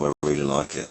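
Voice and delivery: normal talking voice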